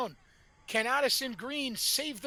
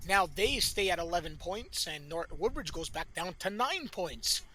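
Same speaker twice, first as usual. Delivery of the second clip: ordinary talk, nothing marked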